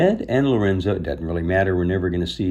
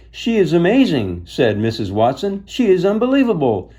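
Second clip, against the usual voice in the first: high, loud, delighted praise; loud high fast admiration; gushing; 9 semitones higher, 5 dB louder than usual. A narrator's reading. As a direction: rave about the pig. Missus Watson gushing.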